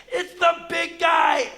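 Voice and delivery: high, loud, drawn-out shout; hammed-up joyful yell; excited greeting. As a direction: holler a big slow hello to a kid. Greeting the boy running up.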